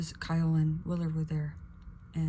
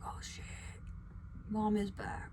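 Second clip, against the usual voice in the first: soft, half-whispered reaction; hushed retold awe; 3 semitones higher, 8 dB quieter, slower than usual. Imitating hyped coworkers seeing mom is back, hushed. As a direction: imitate an awed aside under the breath.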